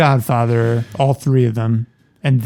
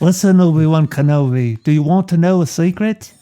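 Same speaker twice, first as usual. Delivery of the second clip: higher British put-on; British accent; 4 semitones higher than usual. Cohost jokes in a British voice to Obi-Wan. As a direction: play it like a sly Brit. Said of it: "It sounds like a British".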